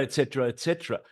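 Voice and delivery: normal talking voice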